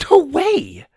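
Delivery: loud, high outburst; shouting. Acted. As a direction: blurt it out in a shout. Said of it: "Susie shouted".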